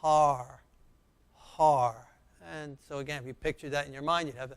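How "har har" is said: Har drawn out, open ah then rolled r; reading aloud slowly. Shown as a demonstration. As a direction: sound out Har for the class. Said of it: "Let's read it together".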